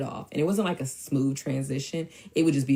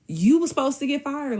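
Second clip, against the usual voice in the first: indignant retort; 12 semitones higher, 5 dB louder than usual. Retold or imitated. Retold scolding of his excuse.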